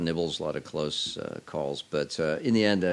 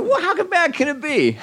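very high, louder comic squeal; mock optimism; 6 dB louder than usual. Host-to-be brushing off the risk, comically optimistic. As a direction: throw it out as a high-pitched punchline. Mock-hopeful punchline, laughing after.

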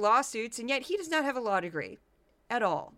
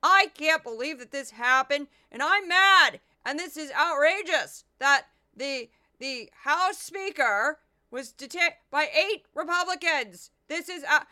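loud, higher, furious ranting; outraged yelling impression; 4 semitones higher, 6 dB louder than usual. Mocking Stephen Miller ranting on Fox, shouting. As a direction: rant like he's outraged all the time. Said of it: "He's like".

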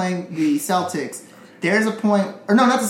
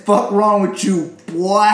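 slow, louder Southern drawl; slow, louder mock Southern taunt; 4 dB louder, slower than usual. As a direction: imitate John Wall's slow Southern scold. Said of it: "That's how he says it".

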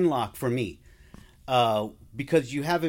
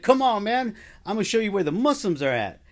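eager invitation; 7 semitones higher, 4 dB louder than usual. Retold or imitated. Eager inmate leads him over.

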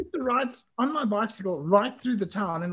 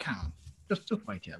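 quiet aside; 9 semitones lower, 7 dB quieter than usual. Retold or imitated. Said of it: "the guy's just like".

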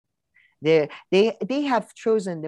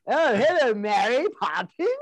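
mock cockney accent, pitched higher; 6 semitones higher than usual. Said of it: "one of the strangest British accents".

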